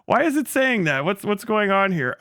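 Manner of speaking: high, baffled, panicky; mock alarm, replaying his confusion. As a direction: act totally thrown by it. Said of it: "I was like".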